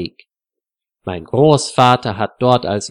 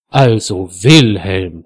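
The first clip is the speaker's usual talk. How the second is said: louder, slower, lower scolding of "Wilhelm"; indignant rebuke; 3 semitones lower, 5 dB louder, slower than usual. A narrator's reading. Frieda scolds her husband for his rude question, indignant. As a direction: drag out the name in mild outrage. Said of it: "sagt Frieda entrüstet".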